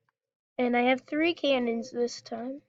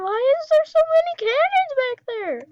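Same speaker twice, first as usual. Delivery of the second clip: shocked shriek; 14 semitones higher, 5 dB louder than usual. Real, not acted. Sees many cannons, shrieks.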